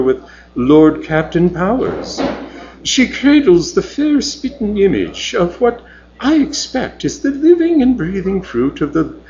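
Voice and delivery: put-on posh English accent, high and prim; upper crust English. Dell announces the visitor mockingly in an upper crust English accent. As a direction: put on a grand English butler's tone. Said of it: "switched to an upper crust English accent".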